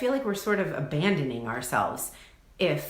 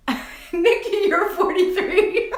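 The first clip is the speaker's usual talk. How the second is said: high, laughing squeal; 13 semitones higher, 7 dB louder, slower than usual. Real, not acted. Host teasing her friend Nikki about her age, laughing hard. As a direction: laugh through the words in delight.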